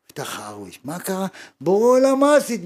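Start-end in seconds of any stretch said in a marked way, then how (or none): 1.6-2.6 crying out to God. Acted. Struck man cries to God.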